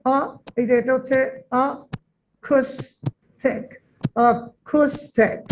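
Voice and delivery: "acoustic" very slowly, in separate syllables; "আ" alone, then each syllable of "acoustic" set apart; syllable-by-syllable pronunciation. Shown as a demonstration. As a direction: sound out "acoustic" as a, coos, tic, with gaps. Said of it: "এটা উচ্চারণ হচ্ছে".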